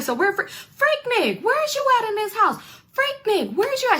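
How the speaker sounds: high mock yell through the house; hammed-up yell, calling for Freaknik. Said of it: "She yelling, like, loud".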